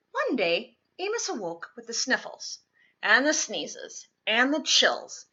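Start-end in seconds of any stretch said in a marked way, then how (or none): none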